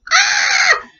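high startled shout of fright. Acted. Apprentices shouting in fright at bannock. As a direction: yelp up high in shock. Tailor and apprentices get a shock.

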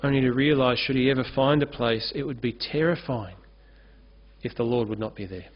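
usual speaking voice

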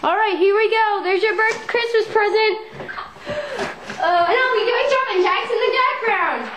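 peppy high workout leader voice; rally the class upbeat; fitness instructor. Acted.

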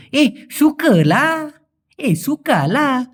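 very slow, stretched এ, সুকালা with a long Malaysian lah pull; Malaysian lah drawl. Shown as a demonstration. Teacher demonstrating the lah tone to learners, slow drawn out. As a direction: draw out সুকালা slowly and high, Malaysian style. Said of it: "মালয়েশিয়ানরা ওই টানটা দিতে গেলে লাহ".

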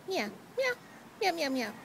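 mock meowing. Owner meowing goodbye to her cat, playful. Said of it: "you repeat the sounds that they make".

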